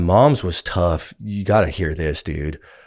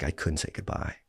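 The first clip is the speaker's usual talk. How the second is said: whispered, breathless confession; dying man's quiet words; hushed whisper; 3 semitones lower, 12 dB quieter than usual. Retold or imitated. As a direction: let it fall to a whisper. Reed's dying words, retold.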